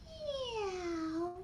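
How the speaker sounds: high, soft meow; cat meow. Shown as a demonstration. Showing the children the cat's meow. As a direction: mew like a little cat. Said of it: "And we're gonna say".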